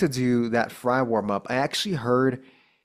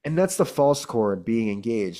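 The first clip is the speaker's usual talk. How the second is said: ordinary delivery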